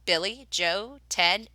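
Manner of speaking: ordinary delivery, nothing marked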